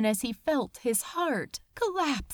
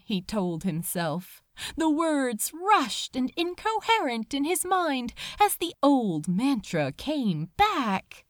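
usual speaking voice

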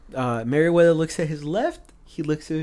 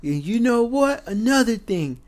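mock drill instructor; 9 semitones higher, 5 dB louder, slower than usual. Drill instructor confronting the trainees.

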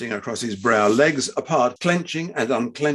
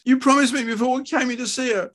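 high, distressed reproach; crying out, pleading; 13 semitones higher than usual. A narrator's reading. Wife reminding husband of his promise, pleading cry. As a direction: plead with the voice pitched way up. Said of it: "cried Doris".

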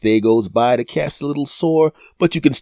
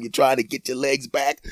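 ordinary delivery

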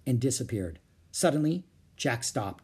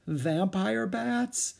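slow, rising, higher-pitched dread; uneasy disbelief; 7 semitones higher, much slower than usual. Acted. Jack reading about bats, feeling faint, uneasy. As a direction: stretch it out as the alarm rises.

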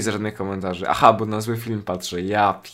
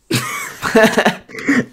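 high breathless laugh; 11 semitones higher, 5 dB louder than usual. Real, not acted. Laughs after the plea.

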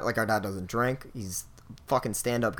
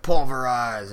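louder mock superhero call; pretend power command; 2 semitones higher, 9 dB louder, slower than usual. Showing how the kid fakes powers, mock command.

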